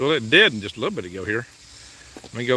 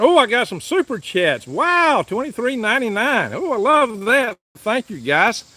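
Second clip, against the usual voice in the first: much higher, delighted squeal of thanks; thrilled; 10 semitones higher than usual. Real, not acted. Vlogger thrilled to see super chats come in, excited.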